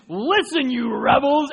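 loud, high rebuke; angry Moses. Acted. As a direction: snap at the crowd. Moses scolding the people.

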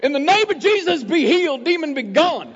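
high, loud hammed-up faith healer command; mock exorcist. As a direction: playfully cast the demon out of the screaming child. Mock exorcism after a child screams.

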